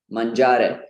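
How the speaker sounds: mangiare as man-JAA-re; Italian mangiare. Shown as a demonstration. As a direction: stress the middle syllable of mangiare, Italian final e. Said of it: "the verb to eat in Italian".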